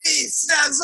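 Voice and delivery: ordinary talk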